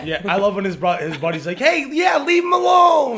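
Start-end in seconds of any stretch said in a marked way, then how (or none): 1.6-3.2 high, loud, slow yell at the bully; mock shout. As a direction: holler it like the kid's buddy stepping in.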